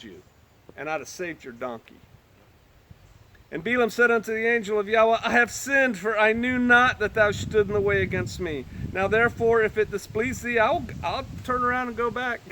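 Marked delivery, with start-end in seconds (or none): 3.5-12.4 measured, lifted Bible reading; solemn King James reading; scripture reading. Acted. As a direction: read the verses with gravity. Reads scripture aloud.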